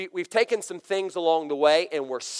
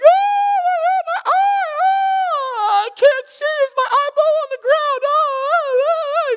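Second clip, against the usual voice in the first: put-on high wailing child voice, much louder; crying hurt boy; 8 dB louder, much slower than usual. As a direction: wail like a little boy hit in the eye. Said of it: "starts crying".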